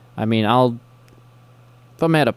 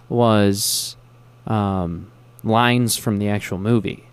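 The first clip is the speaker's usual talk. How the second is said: ordinary talk, nothing marked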